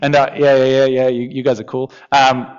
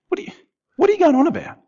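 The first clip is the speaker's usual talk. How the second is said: exasperated; 12 semitones higher, much faster than usual. Acted. Paraphrasing Jesus's exasperation.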